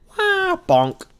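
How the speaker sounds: high, loud comic whoosh and bonk; cartoon crash noise. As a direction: blurt out a cartoon impact sound. Meteor hits the dinosaur.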